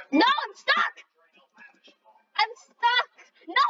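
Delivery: high panicked cry of being stuck; panicked squeal. Real, not acted. Gamer stuck on the course, high panicked squeal. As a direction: squeak it out in alarm.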